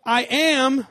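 high, loud defiant yell; retold yell. Kid yelling at dad from video games, high and loud. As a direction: yell it from the couch. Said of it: "they yelled".

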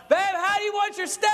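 high, bright mock wife voice asking sweetly; wife's voice. Wife asks about steak amid chaos, high mock voice. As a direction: play the wife cheerfully asking about dinner. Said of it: "And she's like".

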